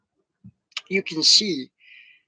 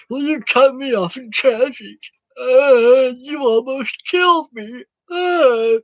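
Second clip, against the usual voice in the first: high, hammed-up whimpering complaint; mock whiny road rager; 8 semitones higher, 4 dB louder, slower than usual. Road rager whining to the driver he chased, mock sobbing. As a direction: whine it like a fake victim sobbing.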